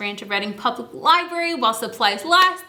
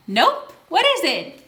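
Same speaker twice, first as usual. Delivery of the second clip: ordinary delivery, nothing marked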